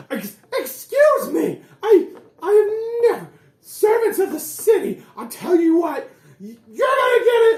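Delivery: high, loud outraged squawk; indignant noble. Acted. Pompous man shoved aside by guards, high indignant shouting. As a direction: sputter with offended fury. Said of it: "He says".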